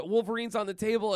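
ordinary delivery

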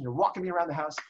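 usual speaking voice

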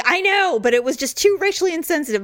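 high, rushed apologetic protest; imitating producer explaining a cut, high and defensive; mimicked defensive producer. Plead it high and fast.

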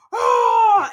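loud, high mock scare yell; jump-scare shout. Imitating a masked guy jumping out, startling shout. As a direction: burst out like someone leaping from hiding.

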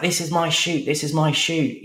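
high-pitched mock shout; retold shouting. Client squaring up to Tom, high mock shouting. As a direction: imitate an irate client, pitched right up. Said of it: "they start shouting at each other".

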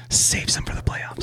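hushed, whispered advice; slow conspiratorial whisper. Pretend. Podcaster advising teams to hold wins back, whispered.

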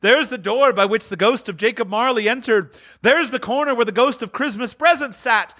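high, eager exclaiming; excited cry. Acted. Scrooge going round the fireplace, excited high exclaiming. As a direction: point things out in delight. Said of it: "cried Scrooge".

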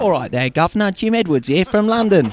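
hammed-up Cockney accent, pitched high on Governor; a jokey Cockney hello to the host; put-on London Cockney voice; mock cockney greeting. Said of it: "I thought I had to do an accent".

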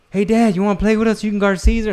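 imitating an eager daughter; a daughter begs dad to play, eager high kid voice; little girl voice. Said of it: "Mickey's like".

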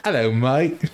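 lightly put-on British greeting; mock British hello; joke British accent. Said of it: "in a British accent as a joke".

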